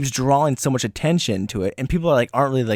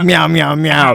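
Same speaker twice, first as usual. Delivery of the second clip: cat meow; 3 semitones higher, 7 dB louder, slower than usual. Pretend. Playing the cat.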